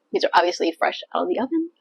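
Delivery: normal talking voice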